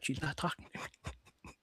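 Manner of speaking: hushed to his sibling; conspiratorial whisper; whispered aside. Retold or imitated. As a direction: keep it secret and quiet.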